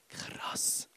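whispered amazement; hushed awe. Retold or imitated. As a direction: drop to a voiceless whisper. Hushed reaction to his vision.

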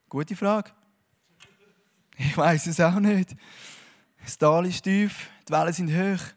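ordinary delivery

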